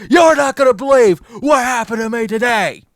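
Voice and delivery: bursting into the house to tell the family, loud and high. Retold or imitated. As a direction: burst through the door with it. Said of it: "kicked open my door and said".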